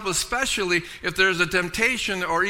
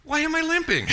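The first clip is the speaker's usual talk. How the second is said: mock surprise; 9 semitones higher than usual. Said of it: "I notice".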